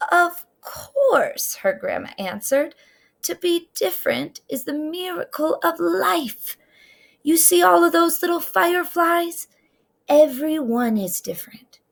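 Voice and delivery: lightly voiced grandma. A narrator's reading. Grandma reassures Trisha.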